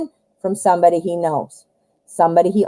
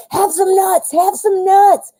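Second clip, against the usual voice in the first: much higher and louder, shoving the offer; pushy nut shover; 11 semitones higher, 4 dB louder than usual. Retold or imitated. Playing the pushy person scaring off the squirrel, overbearing. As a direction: force the nuts on it, way too eager. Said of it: "do it the opposite way".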